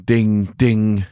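usual speaking voice

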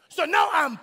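high, slow, loud pissed off; mock anger. Shown as a demonstration. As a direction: play up the anger for the congregation. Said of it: "can you say that in church".

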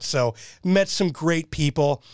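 ordinary talk, nothing marked

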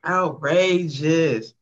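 slow, stretched-out outrageous; drawn out. Real, not acted. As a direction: draw out Outrageous slowly for effect. Host drags out his reaction.

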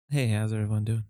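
smooth radio host voice; a hint of public radio announcer; NPR voice. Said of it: "this is my NPR voice".

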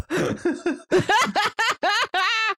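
long high burst of laughter; laughing at the elf joke. Real, not acted. Laughing about elf ears.

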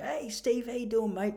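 high-pitched, friendly call-out; chirpy greeting. Retold or imitated. Acts out cheerful greeting.

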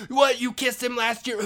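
high, very loud furious yell; enraged. Retold or imitated. Tito confronting his wife over the kiss, enraged yell. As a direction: roar the question in a choking rage. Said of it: "his rage".

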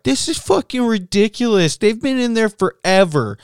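put-on high, loud whiny drunk-lady voice; mock drunk lady. Drunk lady at the bathroom door, high whiny complaint.

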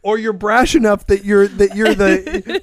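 normal talking voice

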